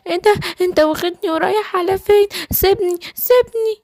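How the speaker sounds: high-pitched panicked pleading; high, struggling little gazelle; frightened captive gazelle. Acted. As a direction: beg high to be let go. Gazelle being carried off by wolf.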